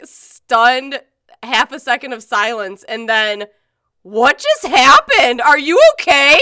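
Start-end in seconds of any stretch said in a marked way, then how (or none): 4.0-6.4 loud, slow, alarmed question; shocked and worried. Retold or imitated. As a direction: sound stunned and worried. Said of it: "Sarah's, like".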